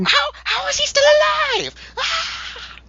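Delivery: hammed-up, very high dub scream; overreacting freak-out. Dub voice of him freaking out, shrieking. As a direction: shriek like an overacted dub actor. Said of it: "dub of him overreacting and freaking out".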